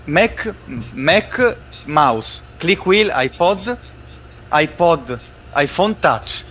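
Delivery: rapt, running translation of a keynote; live keynote interpretation. Acted. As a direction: narrate the launch like you're swept away. Hosts call him in trance.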